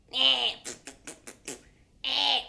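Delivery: short loud creature bleat; ghost baby grunts. Acted. Ghost baby crawling across the ceiling, grunting.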